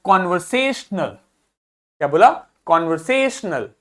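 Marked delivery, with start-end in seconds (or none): 0.0-1.0 conversational with से punched out; stress on से. Shown as a demonstration. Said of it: "उसको थोड़ा तेज बोलना है". 2.7-3.7 कन्वर्सेशनल, weight on से; teacher demonstrating a strong से in कन्वर्सेशनल; stress on से. Shown as a demonstration. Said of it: "से पे अधिक जोर आता है".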